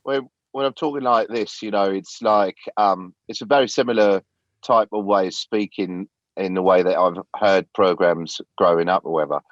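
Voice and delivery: put-on light British English accent, conversational. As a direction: answer like a British guy off the telly. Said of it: "answer the rest of the question as a British guy".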